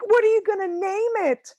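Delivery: high, loud gushing question; mock-excited high-pitched asking; nosy stranger. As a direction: play an over-eager nosy woman. Acting the nosy woman in store.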